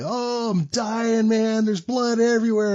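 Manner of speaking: high, stretched-out wail; hammed-up howl, loud by the end; mock screaming. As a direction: imitate the dying-patient act, slow and high. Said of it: "this asshole screaming his fucking head off".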